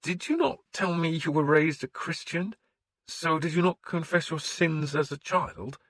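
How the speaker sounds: the priest questioning a pagan lord; wary priest. A narrator's reading. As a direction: probe him gently as the suspicious priest.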